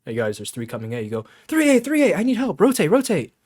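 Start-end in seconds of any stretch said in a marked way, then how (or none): 1.5-3.3 high, loud, rapid comms shout; urgent team callout shout. Shown as a demonstration. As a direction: call it like the site is falling. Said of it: "You use speed to display urgency".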